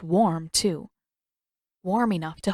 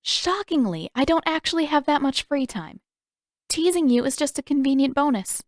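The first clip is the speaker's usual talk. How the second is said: put-on higher, lightly teasing girl's voice; Katara teasing; 7 semitones higher than usual. Katara needling Zuko about her free time, higher and teasing. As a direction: tease him with a dry, playful edge.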